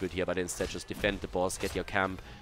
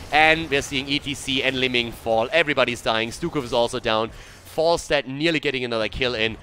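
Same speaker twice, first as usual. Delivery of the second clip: ordinary talk, nothing marked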